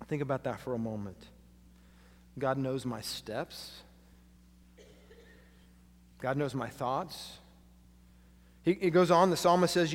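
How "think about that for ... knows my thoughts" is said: quiet reflective preaching. Acted.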